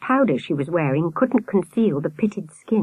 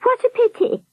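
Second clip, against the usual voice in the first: very high nurse falsetto; nurse's murmured warble. A narrator's reading. Nurse regretting the news, high-pitched warble. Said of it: "the nurse murmured".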